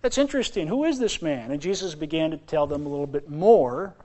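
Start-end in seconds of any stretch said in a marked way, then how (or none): 0.0-1.5 high, wondering voice of the crowd; high, lightly voiced astonished townsfolk; mock astonished crowd. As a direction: play the amazed townsfolk. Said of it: "and they said".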